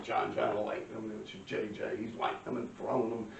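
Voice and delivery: ordinary talk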